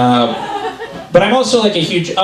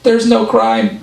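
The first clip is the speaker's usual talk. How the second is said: security guard; 8 semitones higher, slower than usual. Retold or imitated. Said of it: "And then he just goes".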